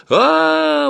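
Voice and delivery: sudden high squeal; loud, long shriek at the top of the range; визг. A narrator's reading. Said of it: "вдруг завизжала она". (пронзительный визг)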